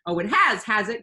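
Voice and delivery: defiant cry. A narrator's reading. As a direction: dare the villain. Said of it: "cried Pippi".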